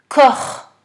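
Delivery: Corps high and loud, on its own; dictated word. Shown as a demonstration. Teacher dictating to pupil, high, emphatic Corps. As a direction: call out Corps high and distinctly.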